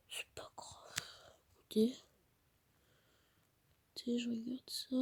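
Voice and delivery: soft unvoiced whisper. Real, not acted. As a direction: barely breathe the words. Whispering, mother downstairs.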